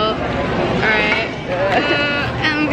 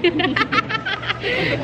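ordinary talk, nothing marked

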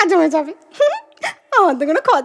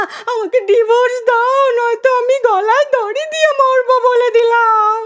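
slow, melodramatic radio-play wailing; hammed-up sobbing lament, pitched up; tearful wife; 4 semitones higher, slower than usual. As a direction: wail it like a weeping wife, stretching every phrase. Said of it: "বউটা কেঁদে কেঁদে বলছিল".

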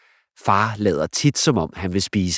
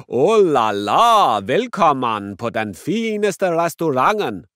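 very slow, drawn-out, louder hammy waiter; Italian waiter; 7 semitones higher, 7 dB louder, slower than usual. Acted. Dad as waiter welcoming the girls, hammy and slow. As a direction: savour every word like a showy waiter. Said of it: "siger far og bukker".

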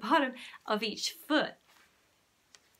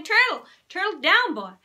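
loud call after a runaway dog; calling the dog, raised and urgent; 9 semitones higher, 11 dB louder than usual. Acted. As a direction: shout the dog's name to stop him.

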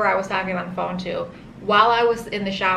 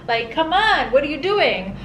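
impatient; 6 semitones higher than usual. Retold or imitated. Hurrying the nurse with IV.

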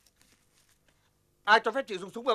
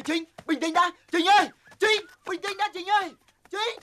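urgent calling out; 8 semitones higher, slower than usual. Acted. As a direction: beg Trinh to calm down, voice raised. Calming a furious woman in fight. (gọi to, khẩn khoản)

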